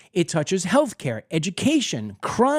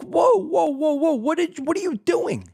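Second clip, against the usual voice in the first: shocked whoa; 10 semitones higher than usual. Pretend. Homeowner catching a guest repainting his walls, shocked high whoas. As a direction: yelp it like you caught someone wrecking your place. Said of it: "I'd be like".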